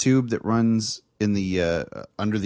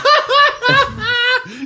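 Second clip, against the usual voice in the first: squealing high laugh; high burst of laughter; 8 dB louder than usual. Real, not acted. Cracks up at mango.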